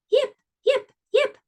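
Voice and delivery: very high, squeaky fox yips; high little fox calling into the night. Acted. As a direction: yelp like a tiny fox calling out. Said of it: "Little Fox called again".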